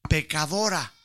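ordinary talk, nothing marked

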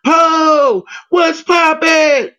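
high, slow shouted greeting; hyped shout back. Retold or imitated. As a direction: yell the greeting back with energy. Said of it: "And she would".

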